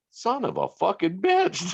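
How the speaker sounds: incredulous high son of a fucking bitch; disbelieving curse. Retold or imitated. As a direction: caught off guard by the photo. They show Harding's home.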